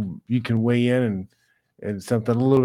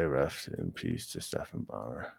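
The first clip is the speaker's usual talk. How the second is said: much lower and softer, hushed respect; solemn tribute; 15 dB quieter than usual. Real, not acted. Streamer honoring Stephan Bonnar himself, low and hushed. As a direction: drop your voice and mean it.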